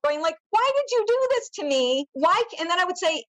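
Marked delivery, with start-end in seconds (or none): 0.5-2.6 imitating yourself yelling in protest, pitched up; retold angry yelling. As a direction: re-enact an anguished outburst at moderate volume.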